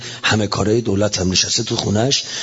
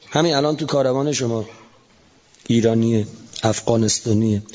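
ordinary delivery

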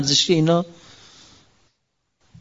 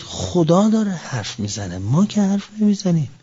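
usual speaking voice

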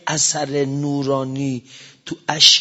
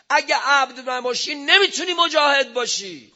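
high, climbing preaching voice; rising fervour; 7 semitones higher than usual. Real, not acted. Preacher urging believers toward purity, pitched high. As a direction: lift the pitch as you build.

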